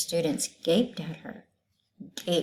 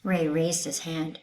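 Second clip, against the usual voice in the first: usual speaking voice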